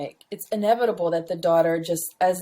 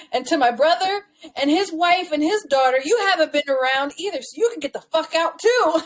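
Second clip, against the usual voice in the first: higher, indignant mock tirade; retold angry dismissal; 6 semitones higher than usual. Dead man's letter to his absent brother, mock indignation. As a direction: throw the relatives out, acted.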